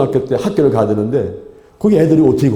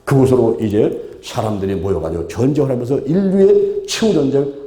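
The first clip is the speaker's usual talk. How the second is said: ordinary talk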